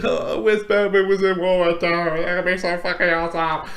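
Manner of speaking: put-on voice of a wishful fan; mock yourself as a gushing fanboy; Batman fan. Batman fan wishing for his hero, in a put-on fan voice. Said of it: "you know me as a Batman fan".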